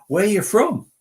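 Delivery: loud, high mock questioning; exaggerated Washington stranger. Retelling a Washington man's holiday question, loud and exaggerated. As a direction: blurt it out as a hammed-up stranger. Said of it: "he didn't say it like that".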